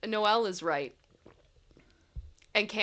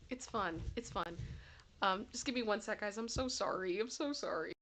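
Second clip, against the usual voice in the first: ordinary delivery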